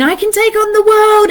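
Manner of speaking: high, excited boast; top-of-the-world brag; mock bravado. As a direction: crow it like you're invincible. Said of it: "It can swing us like whiplash between".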